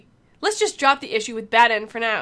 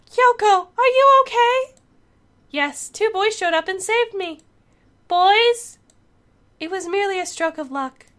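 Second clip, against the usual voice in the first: put-on high anime girl voice; pitched-up girl dialogue; girl character voice; 9 semitones higher, 6 dB louder than usual.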